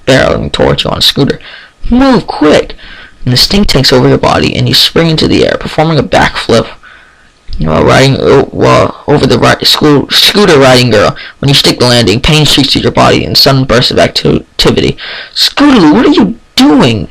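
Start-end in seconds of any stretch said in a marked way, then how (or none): 1.9-2.8 high, sharp warning call; urgent shout. Acted. Scooter girl shouting at bystander to move, urgent. 15.3-17.1 scolding yell. Acted. Dash yelling at Scootaloo for nearly hitting someone, scolding. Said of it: "Dash yells".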